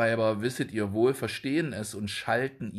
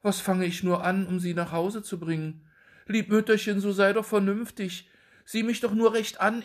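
pleading son; 10 semitones higher than usual. A narrator's reading. Son pleads with confused mother.

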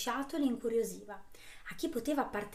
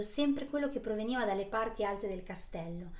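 plain reading voice

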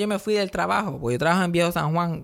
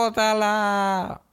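long drawn-out, higher-pitched call; retold yell across street; 4 semitones higher, much slower than usual. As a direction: holler it across the street, stretching the vowels. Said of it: "yo gritando desde el otro lado de la calle".

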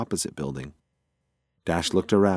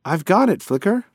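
higher, louder call of triumph; gleeful cry, pitched up; excited call; 10 semitones higher, 4 dB louder than usual. Acted. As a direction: shout the find across the roof. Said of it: "she yelled out that she'd found it".

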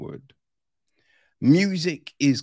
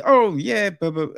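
higher, louder mock protest, fumbling over the buts; hammed-up character sputtering excuses; 7 semitones higher, 4 dB louder than usual. Film reviewer mocks a character's excuses, higher, louder and sputtering. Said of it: "Like, there's just all these".

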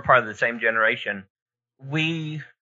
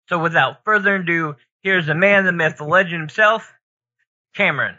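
announcer; 5 semitones higher, 4 dB louder than usual. Pretend. Introducing the co-host.

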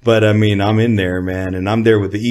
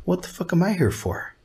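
incredulous; 9 semitones higher, 7 dB quieter than usual. Retold or imitated. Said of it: "I go".

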